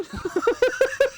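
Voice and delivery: high-pitched burst of laughter; real laugh. Real, not acted. Storyteller laughing after saying that's his wife, real laugh. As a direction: crack up at your own story.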